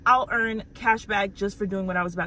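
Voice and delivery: lively, emphatic sponsor pitch; upbeat ad read. Real, not acted. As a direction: sell it with real enthusiasm. Sponsor read with contest announcement.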